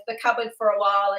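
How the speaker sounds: ordinary delivery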